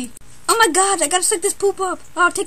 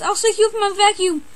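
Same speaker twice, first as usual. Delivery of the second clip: ordinary talk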